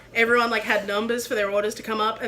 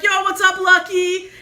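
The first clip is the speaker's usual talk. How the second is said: higher-pitched call to a passing friend; acted hailing; 6 semitones higher, 4 dB louder than usual. Chef greeting Lucky pelting by on a bike, hailing. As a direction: hail a mate riding by.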